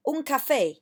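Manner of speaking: teacher modelling Spanish café; model Spanish. Shown as a demonstration. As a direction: say it for the class to repeat. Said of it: "So say after me".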